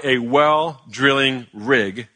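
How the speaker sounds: slow, deliberate phrase; carefully spaced "well drilling rig"; careful tongue-twister. Shown as a demonstration. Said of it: "Say that ten times fast".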